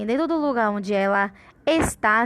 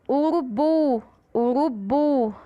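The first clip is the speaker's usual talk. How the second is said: "urubu" with a strong, drawn-out final "bu"; stress on bu; 3 semitones higher, 3 dB louder, slower than usual. Shown as a demonstration. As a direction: push hard on the end of "urubu". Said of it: "O bu a gente fala com mais força".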